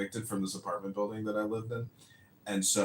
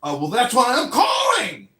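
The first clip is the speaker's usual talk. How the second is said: exasperated shout; 13 semitones higher, 11 dB louder than usual. Retold or imitated. Caller exasperated.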